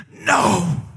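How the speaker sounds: put-on sulky little-kid no; pouting no. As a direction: pout the word out like a toddler. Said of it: "he's pouting".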